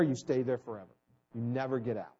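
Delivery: ordinary talk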